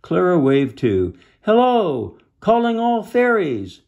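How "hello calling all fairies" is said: high, loud sustained call; singing-out holler in a story; crying out. A narrator's reading. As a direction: hail everyone in the distance. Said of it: "she cried".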